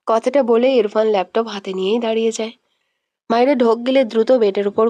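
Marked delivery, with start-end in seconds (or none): none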